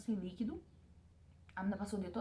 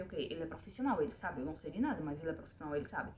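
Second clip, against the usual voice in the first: inner thought; 5 semitones lower, 5 dB quieter than usual. Retold or imitated. Inner thought recalled during exam.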